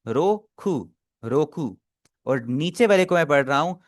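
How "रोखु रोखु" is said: slow demo. Shown as a demonstration. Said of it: "अगर मैं इसे स्लो स्लो पढ़ूं".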